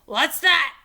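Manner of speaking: high loud startled pirate shout; captain shouting at a sudden shriek. A narrator's reading. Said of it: "he shouted".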